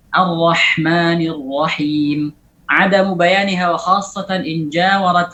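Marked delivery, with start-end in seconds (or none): 0.1-2.3 Ar-Rahmanir Rahim slowly with airy ha; slow demo; breathy ha. Shown as a demonstration. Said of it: "jelaskan hamasnya".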